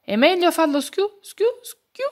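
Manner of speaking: high giggly frog voice, stuck on schiù; a high, cheerful frog who can't get the word out; happy frog stammering. A narrator's reading. The happy frog to the group, high and stumbling. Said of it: "cominciò a dire la rana felice".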